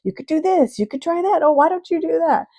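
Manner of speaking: high, loud calls of eager advice; high-pitched pretend shouting, tumbling out suggestions; mock shouting down. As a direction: call down to someone in a pit, imitating pushy helpers. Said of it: "are shouting down saying".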